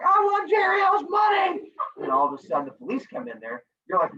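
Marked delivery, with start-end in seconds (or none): 0.0-1.7 high, slow, drawn-out shout; hammed-up clueless bank robber; mock robber demand. Teacher plays student waving avocado in bank, shouting. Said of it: "wave around your avocado and like".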